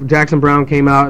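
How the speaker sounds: ordinary delivery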